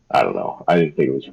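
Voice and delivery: ordinary delivery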